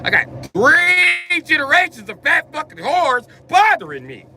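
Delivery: high, loud crying wail; whiny wail. Retold or imitated. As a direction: wail it like a tantrum. Rant peaks.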